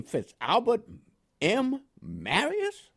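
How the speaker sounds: Southern-free name read slowly with a high questioning rise; puzzled, incredulous reading. Retold or imitated. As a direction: sound baffled by this name, drawn out and up. Lieutenant puzzled reading his name.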